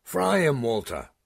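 high, loud cheering call. Acted. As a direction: yell encouragement across the field. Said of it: "calling out".

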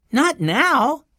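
put-on high-pitched child's refusal; high little-boy voice; little boy Benny. A narrator's reading.

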